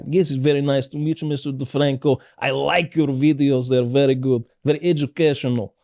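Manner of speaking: lightly put-on Russian accent, formal and polite; in character as Dimitri. To Philip DeFranco, in his Russian character accent. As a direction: stay in character, overly proper. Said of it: "I refuse to break character".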